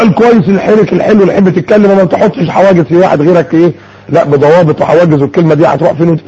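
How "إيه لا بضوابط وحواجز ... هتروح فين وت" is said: ordinary talk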